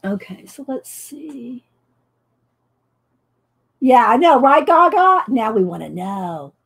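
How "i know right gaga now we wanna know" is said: ordinary delivery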